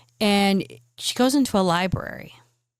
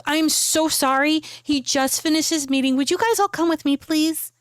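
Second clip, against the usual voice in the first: high-pitched, hurried secretary voice; apologetic secretary; 7 semitones higher than usual. Acted. Secretary fetching the waiting guests for her boss, apologetic and high-pitched. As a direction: usher guests along with a high, apologetic plea.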